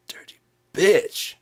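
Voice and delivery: slow, high, loud curse; bitter cursing. Acted. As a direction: spit the insult out slowly. Cursing the quitter.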